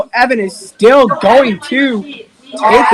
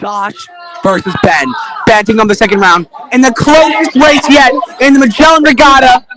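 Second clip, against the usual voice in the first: energetic sportscaster call of a straw-boat race; live play-by-play; 2 semitones higher, 3 dB louder than usual. Acted. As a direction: race commentator calling the action.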